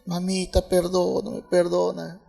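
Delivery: a small son pleading softly with his angry mother; pleading child. Retold or imitated. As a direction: beg for forgiveness meekly. Son begs mother's forgiveness.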